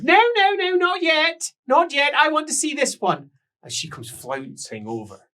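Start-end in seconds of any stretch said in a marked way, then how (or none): 0.0-3.3 very high, loud refusal; loud high protest. Acted. Rouged woman refusing to be taken upstairs, high loud protest. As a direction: protest like a spoilt grande dame.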